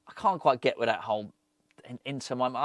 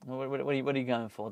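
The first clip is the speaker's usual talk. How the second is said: ordinary talk, nothing marked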